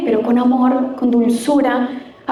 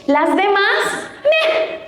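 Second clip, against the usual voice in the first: slow, much higher scornful meh; mocking shrug at the rest; dismissive mock; 8 semitones higher, much slower than usual. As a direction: brush them off mockingly. Mocking view of single women.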